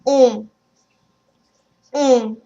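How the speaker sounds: "On" with a firm nasal vowel. Shown as a demonstration. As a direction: say "On" with me, through the nose. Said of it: "pronounce with me".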